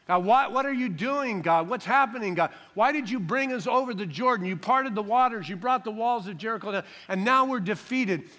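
loud, high-pitched questioning of God; pouring out his heart. Acted. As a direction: plead in bewildered protest. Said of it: "Joshua's pouring out his heart to God".

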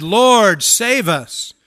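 loud call for rescue; crying out. Retold or imitated. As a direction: cry out to be saved from drowning. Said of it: "they cried".

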